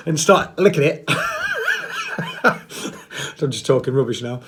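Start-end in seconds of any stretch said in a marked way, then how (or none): 1.1-2.4 high rising real giggle. Real, not acted. Laughing at his own snake joke, high giggle. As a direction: giggle at your own silliness.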